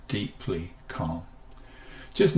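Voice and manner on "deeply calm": slow, soft and low; deeply calm. Shown as a demonstration. Teacher showing an even calmer take, slow and soft. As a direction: sink into the words slowly. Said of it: "or even better".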